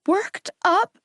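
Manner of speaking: choked, high, cracking disbelief; on the edge of tears. A narrator's reading. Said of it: "I choked out, voice cracking".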